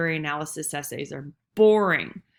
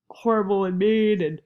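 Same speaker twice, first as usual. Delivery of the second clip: yawning; 4 dB louder than usual. Real, not acted. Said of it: "sorry, I'm yawning".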